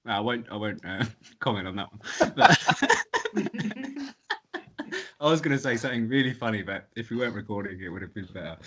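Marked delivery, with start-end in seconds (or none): 2.6-5.1 high laugh. Real, not acted. Laughing mid-sentence.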